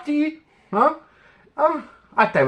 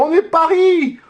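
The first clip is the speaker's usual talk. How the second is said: excited shout; 6 semitones higher than usual. Real, not acted. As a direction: belt it out proudly. Fan chant, loud then soft.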